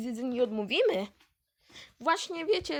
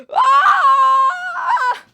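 loud terrified scream; 13 semitones higher, 13 dB louder than usual. Acted. Scream while competing.

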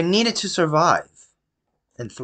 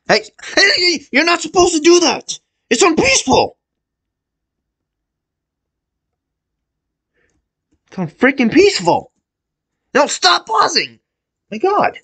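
high, loud furious yells; angry shouting; 4 dB louder than usual. Real, not acted. Gamer furious that the mob attacks on peaceful, yelling high. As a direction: yell at the game in outrage.

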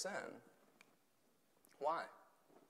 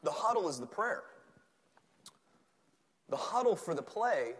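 ordinary talk

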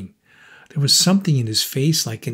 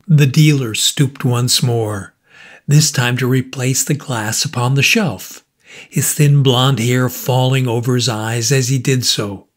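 plain reading voice

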